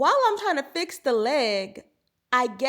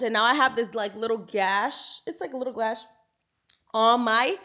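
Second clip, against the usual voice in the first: normal talking voice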